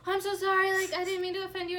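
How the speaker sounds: high, loud flustered apology; mortified apology. Retold or imitated. Student apologising to the speaker, high and loud. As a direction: gush out an embarrassed sorry. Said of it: "mortified a little bit".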